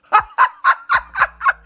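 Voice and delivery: loud, high cackling laugh; just lose it laughing; shrieking laugh at the lie; high burst of laughter. Real, not acted.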